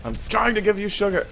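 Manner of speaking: very high mock English accent; hammed-up English whine; Tracer. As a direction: protest in a fake English squeal. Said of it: "I'm adorably English".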